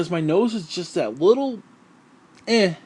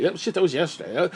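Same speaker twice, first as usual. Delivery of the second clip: ordinary delivery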